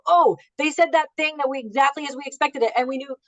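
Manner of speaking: high, delighted rush of relief; mock-excited client bragging on the phone; thrilled client. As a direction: gush like a client who nailed it.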